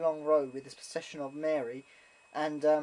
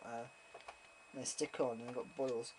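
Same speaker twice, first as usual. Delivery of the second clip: ordinary delivery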